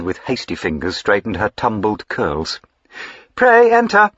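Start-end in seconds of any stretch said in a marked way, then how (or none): 3.4-4.1 much higher, louder bright call; forced jaunty call. A narrator's reading. Diana hides her crying from her aunt, falsely jaunty. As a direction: cover the tears with false cheer. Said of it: "trying to sound jaunty".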